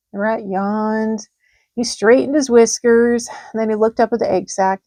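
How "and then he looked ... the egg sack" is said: ordinary delivery, nothing marked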